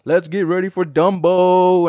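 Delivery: high, loud, slow ring announcer parody; mock boxing announcer call; Michael Buffer announcer. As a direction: belt it like a fight-night intro, but for Dumbo. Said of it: "he's like".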